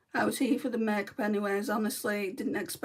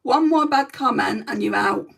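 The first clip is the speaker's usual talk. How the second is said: louder, stern, laying down the law; firm loud warning; 7 dB louder than usual. Real, not acted. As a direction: warn the troll once, firmly. Warning troll in live chat.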